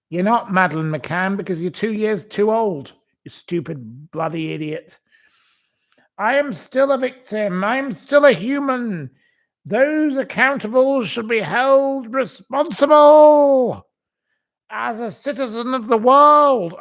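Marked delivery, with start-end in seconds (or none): 6.2-16.7 mock grand protest. Mocking her statement to viewers, high loud declaiming. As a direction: proclaim it like a self-important activist.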